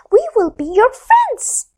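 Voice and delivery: high squeaky little fish voice; tiny fish. Acted. The tiniest fish befriending the moon, high. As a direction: sound small and kind.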